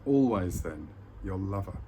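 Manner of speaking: slow, measured poem reading with long pauses; poem recitation. Acted. Speaker sharing a poem with viewers, slow solemn recitation. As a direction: declaim each short line, then let it hang.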